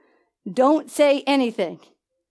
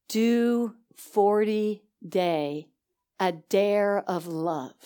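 put-on much lower, slow, softer wise old man; solemn old father; 6 semitones lower, 6 dB quieter, much slower than usual. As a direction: lay the dare on him gently and slowly. Saved father advising.

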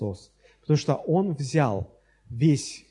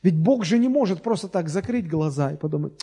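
normal talking voice

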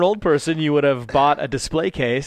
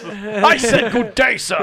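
higher, slow, hammed-up posh British gentleman; mock posh British; 7 semitones higher, 4 dB louder, slower than usual. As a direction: dismiss him grandly in a fake British accent.

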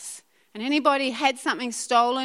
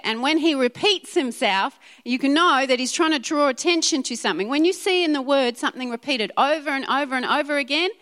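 usual speaking voice

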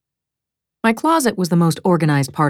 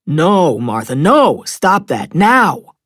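loud, firm scolding of a dog; admonishing; 5 dB louder, slower than usual. Acted. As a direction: scold the dog, punching each command. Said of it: "Joe was admonishing our border collie".

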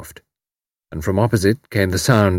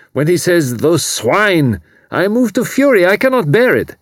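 higher, louder, rising to fury; impassioned young German; 7 semitones higher, 4 dB louder than usual. A narrator's reading. Refugee to Tuppence, torn and furious. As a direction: pour out torn loyalty. Karl's outburst of feeling.

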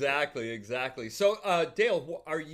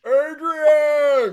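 loud, high Rocky yell for Adrian; Stallone impression; 11 semitones higher, 12 dB louder than usual. Friends riffing on the podcast, loud Stallone yell. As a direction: bellow it like Rocky calling Adrian. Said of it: "I'm just being Sylvester Stallone".

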